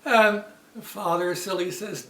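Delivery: ordinary delivery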